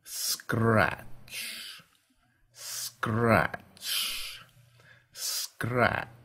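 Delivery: slow, drawn-out whispered "Scratch"; soft scratching. A narrator's reading. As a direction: let each scratch creep in the dark. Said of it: "soft and spine-tingling in the darkness".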